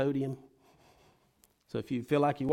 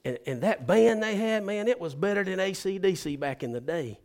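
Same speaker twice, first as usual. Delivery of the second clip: excited churchgoer; 7 semitones higher, 9 dB louder than usual. Retold or imitated. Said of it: "he says".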